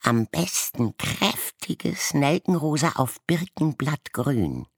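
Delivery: mock the other raven; croaking mockery. A narrator's reading. Striped raven croaking mockery at the blue-spotted one. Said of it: "krächzte ein rosagrün gestreifter".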